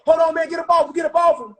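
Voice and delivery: high, loud yell to break it up; hammed-up yell to stop the fight; shouted order. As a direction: pull them apart with your voice. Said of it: "now here they go".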